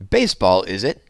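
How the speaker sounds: mother not keen on the idea; disapproving mother. A narrator's reading. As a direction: skeptical ma, unimpressed. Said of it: "the tone of his mother's voice".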